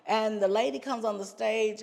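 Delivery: ordinary talk, nothing marked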